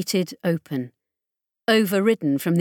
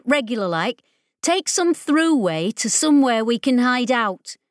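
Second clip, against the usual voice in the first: tough specialist voice; 4 semitones higher than usual. Acted. Ollie argues for fleeing.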